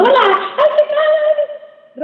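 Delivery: put-on very high, artificial baby-talk greeting; squeaky put-on voice for little kids; talking to children. Shown as a demonstration. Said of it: "ese tono que a veces hablamos a los niños". (tono agudo y artificial para niños)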